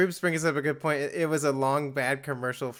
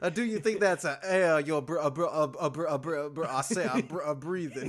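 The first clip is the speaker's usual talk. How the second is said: Foghorn Leghorn voice; 4 dB louder than usual. Retold or imitated. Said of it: "foghorn leghorn".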